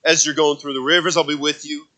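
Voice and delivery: loud, high, fervent preaching. Acted. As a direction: preach it out with full force to the congregation. Preaching at full force.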